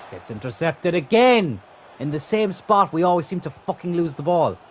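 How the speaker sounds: annoyed streamer complaining; frustrated. Real, not acted. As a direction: vent at losing the ball again. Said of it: "I'm getting frustrated now".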